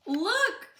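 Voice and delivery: high, loud eager look; excited discovery. Acted. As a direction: point out the surprise. Arthur discovers puppies.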